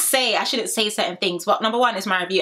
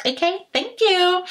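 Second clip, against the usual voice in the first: sassy; 10 semitones higher, slower than usual. Real, not acted. Sassy dismissive sign-off.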